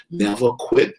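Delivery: ordinary delivery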